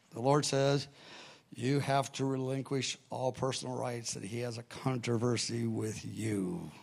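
meek, quiet, mumbled; timid and quiet. Retold or imitated. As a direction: say it sheepishly, looking down. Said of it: "it was pretty pathetic".

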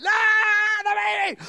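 high, strained mock scream; hammed-up wail of a birthing woman; imitated childbirth scream. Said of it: "and she's screaming".

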